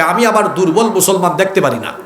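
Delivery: ordinary talk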